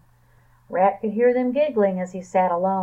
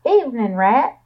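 higher, louder friendly call; 4 semitones higher, 7 dB louder than usual. A narrator's reading. Raccoon calling to Rat, loud and friendly. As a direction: call across the junkyard. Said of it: "called Raccoon".

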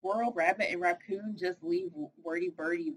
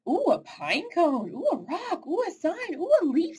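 high, bright oohs of wonder; a bird thrilled by every pine cone; delighted oohs; 10 semitones higher, 5 dB louder than usual. Acted. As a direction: coo over each new find, higher each time. Birdie marvels at things in woods.

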